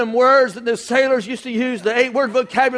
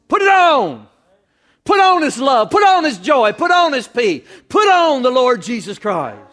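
loud, raised rallying exhortation; full-force preaching; 3 semitones higher, 6 dB louder than usual. Real, not acted. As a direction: drive each command home to the congregation.

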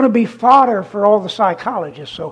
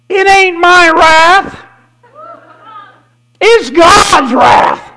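loud, high, very slow proclamation; raised voice; 6 semitones higher, 7 dB louder, much slower than usual. Real, not acted. Prophet proclaiming God's wrath, loud, high and slow. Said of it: "So I raise my voice sometimes".

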